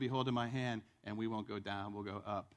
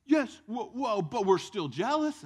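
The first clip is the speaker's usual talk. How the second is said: louder, higher, slow; excited admission; 7 semitones higher, 9 dB louder, slower than usual. Retold or imitated. Jealous crowd admitting it, loud and excited. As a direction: blurt it out excited.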